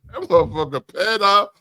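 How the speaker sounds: ordinary delivery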